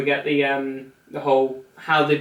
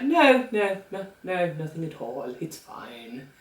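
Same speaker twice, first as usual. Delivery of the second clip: dismissive mumble, fading; 7 dB quieter, slower than usual. Acted. Diana brushing off the curator's question, fading evasively. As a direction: brush it off, sinking to almost nothing.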